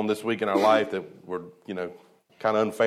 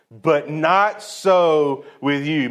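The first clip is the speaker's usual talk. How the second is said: slow and forceful, every word weighted; emphatic; 3 semitones higher, 3 dB louder, much slower than usual. Shown as a demonstration. Preacher reading Jesus's rebuke to the disciples, slow and emphatic. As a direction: lean hard on "not so with you". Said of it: "an emphatic, forceful statement".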